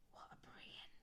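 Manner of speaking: wry soft whisper. Real, not acted. Reader whispers a joke to the child. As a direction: murmur it as a joke aside.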